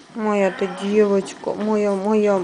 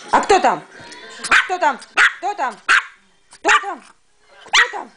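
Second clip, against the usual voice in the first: возбуждённо; 10 semitones higher than usual. Real, not acted. Egging the dog to bark.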